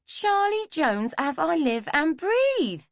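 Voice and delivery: high, slow, swooping woman's voice; delighted recognition. A narrator's reading. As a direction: greet an old acquaintance with loud, drawn-out surprise. Woman greets old acquaintance in story.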